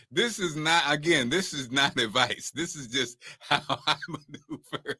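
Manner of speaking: struggling to get it out through laughter; soft, giggly words broken up by laughs; laughing while talking. Real, not acted. Speaker insisting to friends it is not advice, laughing. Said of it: "can't even get it out without laughing".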